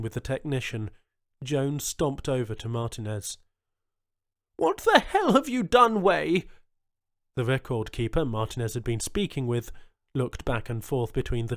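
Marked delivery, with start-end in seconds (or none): 4.6-6.5 high, loud outraged demand; furious governor. A narrator's reading. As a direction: storm in on him shouting. Jones confronts cousin Martinez.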